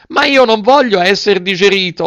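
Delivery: high, loud wailing protest; tearful yell. A narrator's reading. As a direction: yell it out, on the edge of crying. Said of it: "urlò Pinocchio ricominciando a piangere".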